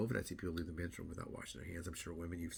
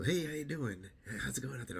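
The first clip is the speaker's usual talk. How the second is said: cheery passerby; 7 semitones higher, 4 dB louder than usual. Retold or imitated. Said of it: "I'd walk on the trail".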